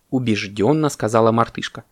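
ordinary delivery, nothing marked

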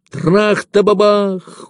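very high, stretched explosion noise; slow, dramatic cannon crash; imitated gun blast. A narrator's reading. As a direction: sing out a long artillery boom. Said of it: "ударило ещё три раза подряд".

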